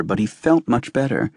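ordinary delivery, nothing marked

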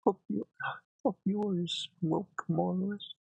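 bad, overdone Canadian accent. Shown as a demonstration. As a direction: try a Canadian accent, poorly. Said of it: "I wanna do a Canadian accent".

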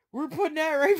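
usual speaking voice

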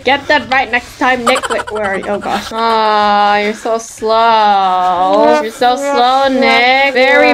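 normal talking voice

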